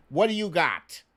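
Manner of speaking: ordinary talk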